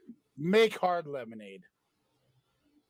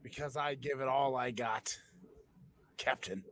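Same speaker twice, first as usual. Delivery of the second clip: low gruff pirate; 4 semitones lower, 5 dB quieter than usual. Pretend. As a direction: growl it like a pirate. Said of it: "going to a pirate voice tonight".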